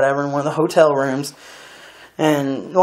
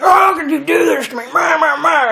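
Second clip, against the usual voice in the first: much higher mocking voice of a weeping girlfriend; high mock sobbing girlfriend imitation; mock crying girlfriend; 12 semitones higher than usual. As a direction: parody her tearful complaint, pitched way up. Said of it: "She's, like, all crying".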